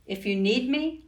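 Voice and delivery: ordinary delivery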